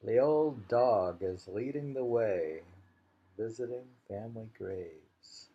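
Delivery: loud, rising haiku reading; poem recital. Acted. Reading the dog haiku to a friend, loud recital. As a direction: announce the poem with weight on old.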